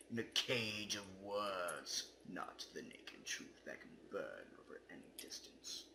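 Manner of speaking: hushed, secretive whisper; spooky whisper. Acted. Reading the game's ending poem aloud, in a whisper. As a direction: breathe it like a secret.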